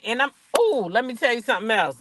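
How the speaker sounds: ordinary delivery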